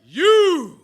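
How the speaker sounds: drawn-out stadium call, voice climbing high; rallying shout. Real, not acted. Speaker firing up the audience with a drawn-out rallying shout. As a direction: rev up the crowd like a team captain.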